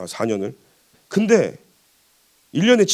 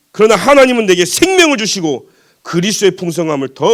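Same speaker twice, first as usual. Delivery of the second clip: raised, insistent preacher's proclamation; emphatic preaching; 3 semitones higher, 4 dB louder than usual. Real, not acted. Preacher proclaiming God's gift of life, emphatic. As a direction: drive the promise home to the congregation.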